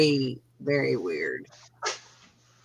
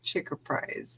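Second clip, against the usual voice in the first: soft unvoiced repeat; whispered echo; 2 semitones lower, 12 dB quieter than usual. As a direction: whisper it back. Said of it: "There you go. That's a good one".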